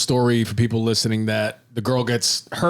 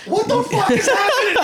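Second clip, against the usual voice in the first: much higher, squealing through a laugh; laughing disbelief; 3 dB louder than usual. Retold or imitated. Recalling watching the arm scene, laughing shocked. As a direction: replay the shock, cracking up. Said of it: "I was like".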